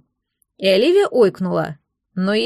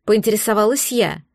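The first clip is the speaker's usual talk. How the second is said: usual speaking voice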